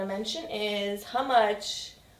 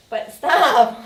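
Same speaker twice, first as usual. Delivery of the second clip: laughing protest; 7 dB louder than usual. Real, not acted. Laughing, telling partner to stop.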